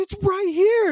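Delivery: very high, loud mock protest; squealed, hammed-up exasperation; frantic insistence. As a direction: imitate a man at his wits' end. Retold coworker frantic about his car.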